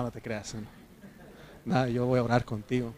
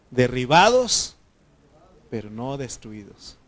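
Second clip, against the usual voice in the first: scripture declamation; 5 dB louder, slower than usual. Acted. Preacher reading verses to the congregation, emphatic scripture declamation. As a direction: call out each hardship, then drop for the reply.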